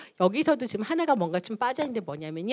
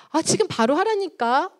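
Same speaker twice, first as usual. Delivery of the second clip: higher, slow exasperated mock scolding; angry mother; 7 semitones higher than usual. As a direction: insist like a fed-up mum. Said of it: "왜 우리 엄마 맨날 화를 내는지".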